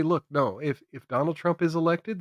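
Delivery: normal talking voice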